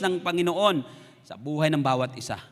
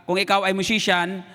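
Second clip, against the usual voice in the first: ordinary delivery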